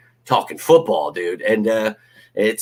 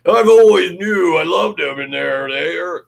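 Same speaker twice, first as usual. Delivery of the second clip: mock bandwagon fan; 8 semitones higher, 5 dB louder, slower than usual. Mocking fair-weather fans.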